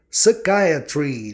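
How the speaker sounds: "Psychiatry" very slowly, stressed "eye" in the middle. Shown as a demonstration. Teacher demonstrating the exception to students, slow "Psychiatry" with stressed "eye". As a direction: draw out "Psychiatry" as suh-KAI-uh-tree. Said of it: "o I especialmente ou excepcionalmente soa".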